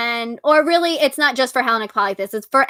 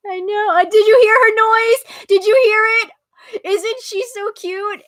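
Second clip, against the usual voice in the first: higher, cooing pet voice; 9 semitones higher, 3 dB louder than usual. Real, not acted. Cooing to viewers about her cat's whine, in high baby talk. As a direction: melt over the cute cat.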